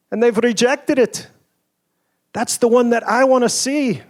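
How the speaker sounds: a light Scottish lilt for the executive's pointed question; John Barber's Scottish accent. Retold or imitated. Inventor retelling the executive's dinner question, light Scottish accent. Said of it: "in his accent".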